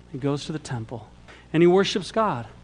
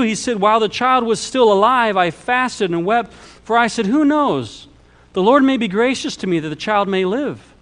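higher, louder declaimed Bible narration; emphatic scripture reading; 6 semitones higher, 5 dB louder than usual. A narrator's reading. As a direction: read the passage out with raised, driving voice.

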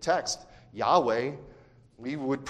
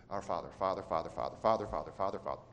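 low, soft, careless repetition; rote mumbled prayer; 7 semitones lower, 10 dB quieter, much faster than usual. Shown as a demonstration. Churchgoer praying carelessly by rote, low mumble. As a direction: rattle it off quietly without thought. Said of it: "as rote".

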